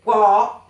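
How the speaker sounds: "wo" in Mandarin third tone, drawn out with a dip; tercer tono chino. Shown as a demonstration. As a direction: say "wo" slowly in a dipping third tone. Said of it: "lleva el tercer tono". ("wo" con el tercer tono del mandarín)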